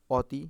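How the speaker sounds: "অতি" said ɔ-ti, loud open ɔ; open ɔ sound. Shown as a demonstration. Teacher trying the unused form on pupils, open ɔ. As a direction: read "অতি" as spelt, with ɔ not o. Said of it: "আমরা অতি বলি না".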